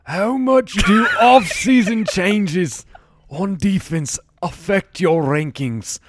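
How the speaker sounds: reading the listener question as a hammed-up Brit; bad British accent. Host reads fan question on request, in a clumsy British accent. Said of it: "read it in a British accent in honor of [name]".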